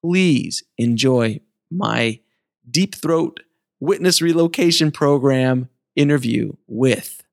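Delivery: ordinary delivery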